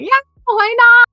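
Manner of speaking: high, loud eager reply; eager yes. Retold or imitated. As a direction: blurt it out happily. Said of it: "And I was like".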